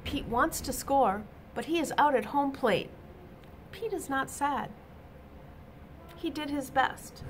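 ordinary delivery, nothing marked

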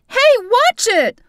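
high, loud shriek, slowed down. Acted. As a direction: yelp it as someone crashes into you. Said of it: "a woman's voice shrieked".

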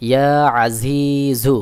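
slow ইয়া আজিজু, pressing from mid-throat at the a of আজিজু; pressed ain. Shown as a demonstration. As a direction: start আজিজু with a slightly squeezed throat ain, vowels drawn out. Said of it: "গলার মধ্যখান থেকে একটু চাপ দিয়ে উচ্চারণ করতে হবে".

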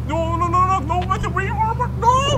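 high, loud frantic cries; panicked yelling. Real, not acted. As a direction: yell it in rising panic. Panics at driver turning wrong.

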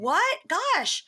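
high, loud gasp of dismay; aghast. Retold or imitated. Told of the car accident, a high loud aghast cry. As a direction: recoil at bad news, high. Reacting to terrible news.

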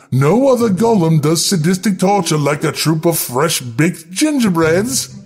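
plain reading voice

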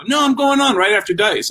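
high-pitched, urgent objection; insistent protest. Retold or imitated. Young comic arguing for his stage spot, insistent.

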